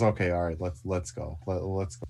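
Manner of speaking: low, soft in-character voice; subdued resolve. Acted. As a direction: say it low and quiet after a deep breath. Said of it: "inhales deeply, um, and says".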